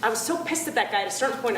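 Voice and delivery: usual speaking voice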